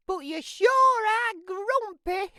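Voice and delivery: very high, loud doting mum voice; mum's cry of joy. Acted. Mum greeting Jim with a hug, very high and loud. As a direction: squeal it like a mother thrilled to see her son.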